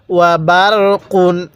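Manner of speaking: "ওয়া বারকুন" read through to a full kun; joined reading. Shown as a demonstration. Teacher showing reading without a stop, joined recitation. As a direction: sound the whole ending of বারকুন, no stop on q. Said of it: "যদি ওয়াকফ না করি".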